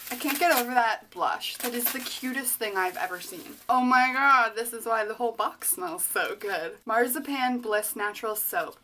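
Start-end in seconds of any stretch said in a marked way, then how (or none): none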